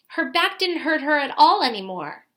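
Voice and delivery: ordinary delivery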